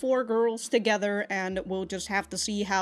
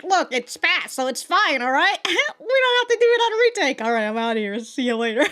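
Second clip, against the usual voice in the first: mock carefree co-stars brushing off retakes, pitched up; breezy voice actors; 5 semitones higher, 5 dB louder than usual. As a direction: lightly imitate a cast member who can't wait to leave. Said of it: "the other voice actors are just like".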